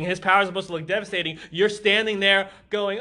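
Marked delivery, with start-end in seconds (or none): none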